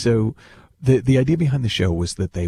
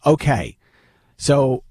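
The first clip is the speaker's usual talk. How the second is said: stage manager in a mock Jersey accent, bored and a bit higher; 6 semitones higher, 5 dB louder, slower than usual. Stage manager briefing phobic guests, mock bored Jersey accent. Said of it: "in a very kind of bored voice".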